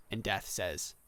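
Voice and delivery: usual speaking voice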